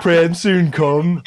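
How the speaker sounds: slow, exaggerated mimicry of a footballer; mimicked catchphrase. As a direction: stretch it out in a hammed-up imitation. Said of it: "do an impression of Prem soon come".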